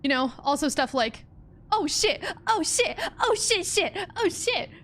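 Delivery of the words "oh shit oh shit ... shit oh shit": high-pitched repeated exclamations; Jenna Marbles. Retold or imitated. Quoting a YouTuber stuck in her head, high squeal. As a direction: squeal it in quick, high bursts. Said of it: "From Jenna Marbles".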